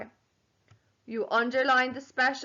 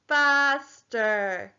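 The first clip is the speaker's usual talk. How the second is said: slow, stretched FASTER; syllable counting. Shown as a demonstration. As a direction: draw out both syllables of FASTER. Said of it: "Let's count the syllables".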